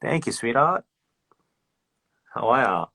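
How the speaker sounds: casual Aussie. Retold or imitated. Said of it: "it's very casual".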